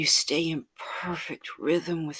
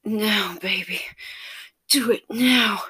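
higher, louder, pleading and breathless; lover's urgent plea; 6 semitones higher, 8 dB louder than usual. Acted. Lover urging her partner on, breathless pleading. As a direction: beg for it right now. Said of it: "I heard your sweet voice saying".

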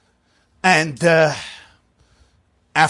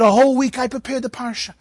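put-on higher, aggrieved old man's protest; indignant old man; 9 semitones higher than usual. Old Moshe complaining to the gabbai, high and aggrieved. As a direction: whine it like a wronged old reader.

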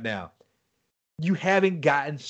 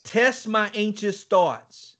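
slow, earnest prayer; prayerful plea; 5 semitones higher, much slower than usual. Retold or imitated. Quoting David's psalm to his friend, slow prayer. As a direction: pray it slowly. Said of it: "like what David wrote in".

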